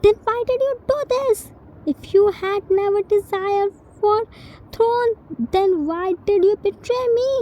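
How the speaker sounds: high, fast cry of betrayal; betrayed heir crying out at his trusted protector; anguished shout. Acted. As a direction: hurl the questions at the traitor, voice pitched high.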